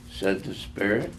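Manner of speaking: ordinary delivery, nothing marked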